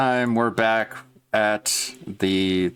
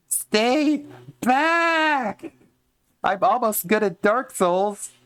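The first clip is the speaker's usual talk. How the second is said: much higher goblin voice; slower than usual. Acted. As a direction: pitch way up like a cornered goblin. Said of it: "Just a mad, angry goblin".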